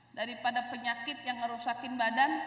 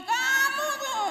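put-on much higher, loud, slow angry yell at the child; scolding shout; 11 semitones higher, 6 dB louder, much slower than usual. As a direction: berate the kid, voice cracking. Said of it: "anaknya dimaki-maki". (bentakan marah ke anak)

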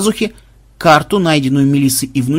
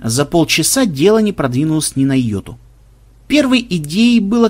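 ordinary delivery

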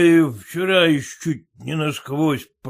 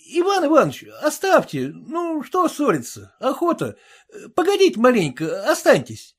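higher, coaxing tavern keeper; a buffet keeper calming a customer; barkeeper voice; 9 semitones higher than usual. Acted.

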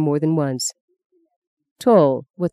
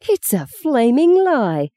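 higher, slow, indignant denial; indignant outburst; 8 semitones higher, 3 dB louder, slower than usual. A narrator's reading. Sister denying she liked the cardinal, slow and indignant. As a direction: hurl it back, slow and outraged.

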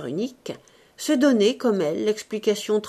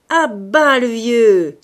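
loud, slow, strained jeering cry from the crowd; feverish shrill heckle; 3 semitones higher, 12 dB louder, much slower than usual. A narrator's reading. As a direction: yell it as a mocking heckler in a thin voice. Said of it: "se cria à lui-même d'une voix fébrile et pointue". (cri fébrile et pointu, très fort)

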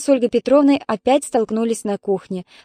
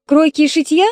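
mocking rise on шитья, a touch higher and louder; scoffing question; 7 semitones higher, 6 dB louder than usual. Sister mocks the mother's suggestion, scornful. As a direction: sneer out «шитья» as a silly idea. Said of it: "возмутилась Светлана".

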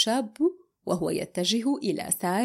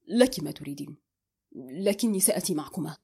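decisive; 6 semitones lower, 3 dB quieter than usual. A narrator's reading. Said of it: "قال بحسم".

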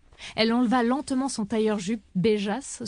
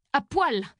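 higher-pitched, restrained yell; yelled order; 4 semitones higher than usual. A narrator's reading. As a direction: snap the order at her, mildly raised. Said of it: "Alexia lui hurla dessus". (ordre hurlé, avec retenue)

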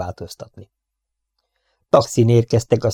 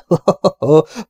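high, loud acted laugh; 7 semitones higher, 6 dB louder than usual. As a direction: laugh out high before replying. Mocking laugh before sarcastic praise. (magas, hangos, megjátszott nevetés)

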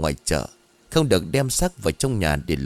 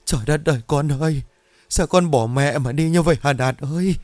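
high, keening wail over the coffin; wailing mother; 7 semitones higher than usual. A narrator's reading. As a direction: wail for the dead son, voice rising. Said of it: "ôm lấy chiếc quan tài rồi khóc giống cả lên".

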